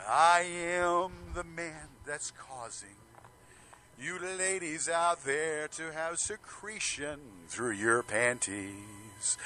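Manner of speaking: ordinary delivery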